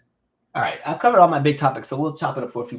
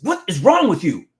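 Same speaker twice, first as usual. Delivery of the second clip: higher, louder scolding teacher voice; mock angry teacher; 6 semitones higher, 5 dB louder than usual. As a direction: snap at the student like a mean teacher. Retelling angry teacher's reaction.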